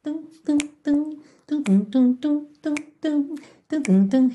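vocal beat. Shown as a demonstration. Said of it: "beatboxing the rhythm".

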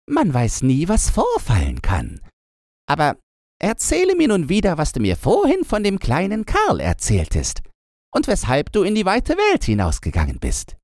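put-on a wild girl demanding a story; robber girl voice. A narrator's reading.

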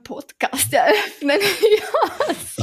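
laughing it out as the joke lands; amused laugh through the words. Real, not acted. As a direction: break into a high laugh at the end. Laughing at own podcast joke.

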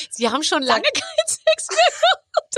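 high-pitched, breaking into laughter; laughing mock quote. Imagining someone walking up with that insult, laughing helplessly. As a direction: crack up while repeating the insult. Repeats the insult while cracking up.

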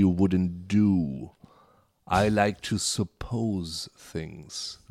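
langsam, feierlich, englisch zitiert; sehr langsame, getragene Lehrerstimme; Ken Campbell. Retold or imitated. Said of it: "alter Theaterlehrer Ken Campbell, der hat immer gesagt". (langsam zitierter Theaterlehrer)

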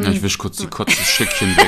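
laughing high while teasing. Real, not acted. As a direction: giggle through the words. Teasing friend after burp.

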